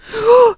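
short high, loud shriek; startled high "uh"; scream. A narrator's reading. Anne-Sophie sees a face in the mirror, screaming. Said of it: "skreg hun".

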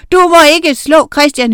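high, loud wail of protest; shrieking, outraged child; howled protest. A narrator's reading. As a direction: howl at him to stop. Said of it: "hylede Anne Sofie".

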